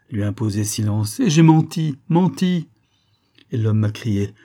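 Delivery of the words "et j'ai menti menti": high, loud cry of guilt; raised, remorseful outburst; anguished self-accusation. A narrator's reading. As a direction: cry out the confession in torment. Anguished confession of a lie.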